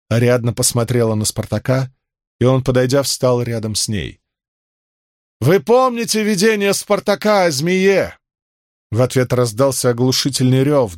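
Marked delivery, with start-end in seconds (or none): 5.4-8.2 priestess addressing crowd. Acted.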